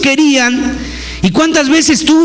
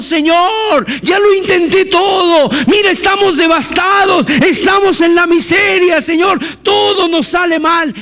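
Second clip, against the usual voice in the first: shouted higher cry to God; desperate shouted plea; 5 semitones higher than usual. Acted. People crying out to the Lord in despair, shouted.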